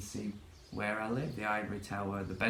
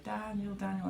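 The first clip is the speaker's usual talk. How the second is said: mock calling out; 10 semitones higher than usual. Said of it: "she was waving to me from a balcony".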